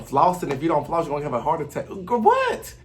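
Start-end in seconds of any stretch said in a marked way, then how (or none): 2.0-2.7 way higher, stunned disbelief; shocked exclamation to the viewers; incredulous. Real, not acted. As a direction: react like it made no sense at all. Incredulous reaction to Jocelyn's advice.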